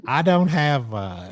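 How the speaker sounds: slow Southern drawl imitation; Southern accent. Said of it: "do your southern accent".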